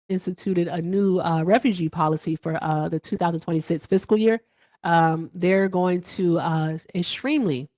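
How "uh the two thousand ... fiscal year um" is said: usual speaking voice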